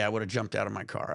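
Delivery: normal talking voice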